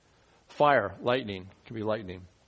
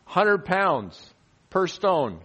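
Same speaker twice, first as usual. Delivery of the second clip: slow emphasis; 4 semitones higher, 5 dB louder, much slower than usual. Acted. Stressing the hail's weight.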